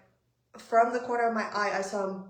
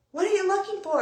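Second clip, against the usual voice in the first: retold yell across the yard, higher and louder; raised-voice, high challenge; shouted question; 10 semitones higher, 7 dB louder, faster than usual. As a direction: call it out like an annoyed neighbor. Neighbor quoted in a shouted exchange.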